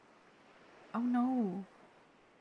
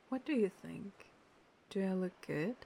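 normal talking voice

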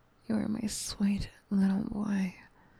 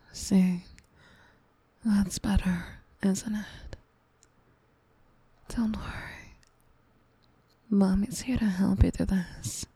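ASMR whisper. Acted. Mommy comforting her upset gamer sweetie, tender whisper. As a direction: whisper close to the mic like a soothing mommy.

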